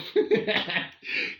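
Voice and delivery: high breathy burst of laughter at dad; sudden unvoiced laugh. Real, not acted. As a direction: laugh out loud, delighted. Dad buggers off ahead, amused.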